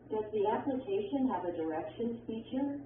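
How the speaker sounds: yes/no question rising at "feature"; rising intonation. Shown as a demonstration. Textbook example of a yes/no question, rising intonation. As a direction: let the voice go up at the end on "feature". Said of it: "arrows that show rising intonation".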